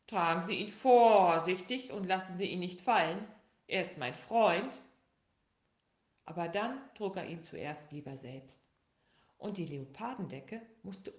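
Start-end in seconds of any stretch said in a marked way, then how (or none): 0.1-4.8 louder, urgent little-bear voice; insist, he is your friend; little bear pleading. A narrator's reading. Little bear urging the carriers, loud plea. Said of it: "sagte der kleine Bär".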